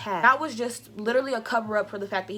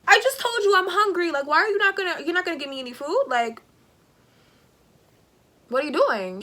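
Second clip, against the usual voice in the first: higher and louder, fed up and demanding; exasperated outburst; 6 semitones higher, 4 dB louder than usual. Retold or imitated. Indignant outburst at her date over food. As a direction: vent at him for not buying food.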